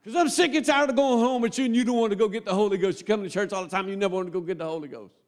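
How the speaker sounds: rapid-fire acted nagging, starting high; fed-up rant. Preacher voices a spouse tired of going home together, fed-up rant. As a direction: rattle off the complaint without a break.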